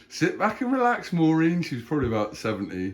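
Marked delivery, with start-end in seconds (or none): 0.1-1.6 high, loud soothing instructor; driving instructor. Retold or imitated. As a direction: coax the learner calmly, pitched up. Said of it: "And he goes".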